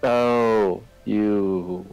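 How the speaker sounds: normal talking voice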